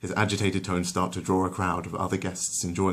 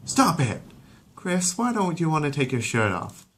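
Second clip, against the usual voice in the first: stern then concerned mum; 9 semitones higher than usual. Acted. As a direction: halt him, then ask the son. Lois stops Peter, asks Chris.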